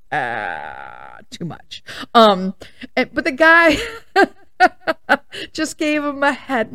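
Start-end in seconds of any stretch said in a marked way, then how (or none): 0.1-1.8 whispered embarrassed aside. Retold or imitated. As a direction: breathe it out, embarrassed. Mom's embarrassed reaction.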